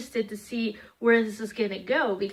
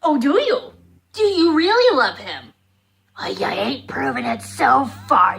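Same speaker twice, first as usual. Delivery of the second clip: loud mocking sarcasm, louder throughout; sarcastic retort; 2 semitones higher, 7 dB louder than usual. Fan scolding Hawkmoth on screen, loud and sarcastic. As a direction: talk back to the screen with scorn. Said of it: "I'm sitting there at least going".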